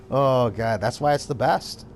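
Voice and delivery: ordinary talk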